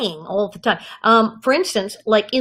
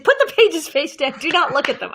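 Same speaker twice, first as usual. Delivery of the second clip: higher-pitched, emphatic order; urgent instruction; 6 semitones higher than usual. Retold or imitated. Father coaching his daughter before the audition, urgent insistence.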